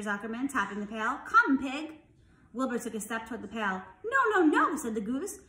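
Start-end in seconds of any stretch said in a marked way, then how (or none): 1.2-2.0 raised, louder coaxing call; calling the pig. A narrator's reading. 4.0-4.8 high, loud goose protest; urgent warning. A narrator's reading.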